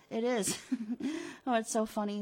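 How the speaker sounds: acted amusement, breaking into a chuckle; tickled, chuckling insistence; laughing. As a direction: speak through a fit of giggles. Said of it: "and you're laughing?".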